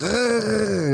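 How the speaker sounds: gargling noise. Retold or imitated. Demonstrating how the baby sounded, gargling noise. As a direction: gargle like a baby spitting out water. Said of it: "so it sounds like".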